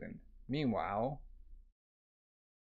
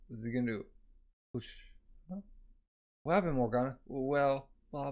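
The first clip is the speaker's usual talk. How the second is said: ordinary delivery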